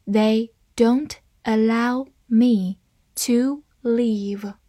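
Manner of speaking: slow, pausing between words; slow reading. Shown as a demonstration. Teacher models the sentence for learners, slow reading. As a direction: give each word its own beat, slowly. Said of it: "好，慢慢来读".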